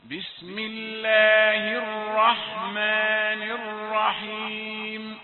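slow, melodic, sustained chanting; Quranic recitation. Acted. Teacher recites the sura for students to follow, slow chanting. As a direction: chant each verse slowly and let it ring.

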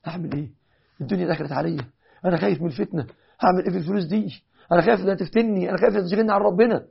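hammed-up worried whine; mock anxious wail. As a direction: play a man fretting over a gift. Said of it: "يقعد يعيط".

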